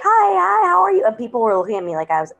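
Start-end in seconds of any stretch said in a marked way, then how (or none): 0.0-1.0 high-pitched, eager hello to strangers; over-friendly greeting. Retold or imitated. Newcomer greeting strangers brightly.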